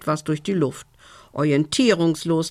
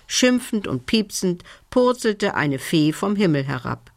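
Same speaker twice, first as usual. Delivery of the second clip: ordinary delivery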